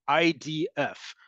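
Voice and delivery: I D F spelled as three separate letters, slowly. Shown as a demonstration. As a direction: say "I D F" letter by letter, slow and clear. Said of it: "Listen to how I said that".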